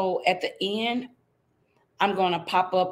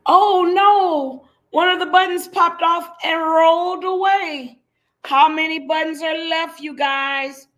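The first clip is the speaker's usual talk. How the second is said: much higher and louder oh no; dramatic dismay; 8 semitones higher, 8 dB louder than usual. Acted. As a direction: gasp it out like a disaster. Dramatic storybook moment.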